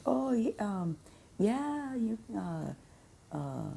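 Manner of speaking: drawn-out hemming and hawing; hesitant employees. Retold or imitated. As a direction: start high, then trail off softly as if too shy to ask. Voicing employees unsure about a drink.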